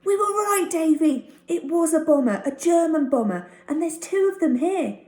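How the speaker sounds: high and loud, tumbling out the news; bursting to tell it all; excited boy. Acted. Tucky excitedly explains to Davy.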